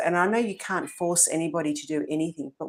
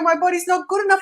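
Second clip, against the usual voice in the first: put-on higher, louder, fast whining teen; mock whining teen; 13 semitones higher, 5 dB louder, faster than usual. Mocking her teenage self's complaint, high fast whine.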